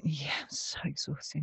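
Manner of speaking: faint, breathy whisper; exhausted whisper. Retold or imitated. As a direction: barely breathe it out. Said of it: "tired self answers".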